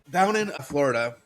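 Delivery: normal talking voice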